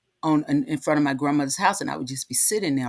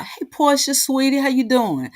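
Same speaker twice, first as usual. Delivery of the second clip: bright sing-song hello, much higher; high cheerful hello to cousin; high cheery greeting; 11 semitones higher, 4 dB louder than usual. Real, not acted. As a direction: call out sweetly to a cousin, pitched way up.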